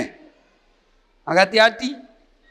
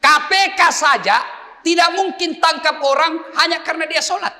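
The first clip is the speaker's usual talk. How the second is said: shouting; 11 semitones higher, 4 dB louder than usual. Acted. Preacher shouting in sermon.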